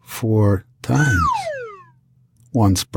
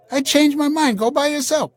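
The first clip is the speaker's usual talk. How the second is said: very high mock wife voice; imitating the wife. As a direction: toss it off like a fickle wife.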